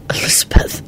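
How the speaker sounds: hushed, stunned voice; stunned, breath-only utterance. A narrator's reading. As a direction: say the name as if stunned. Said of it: "said in a stunned voice".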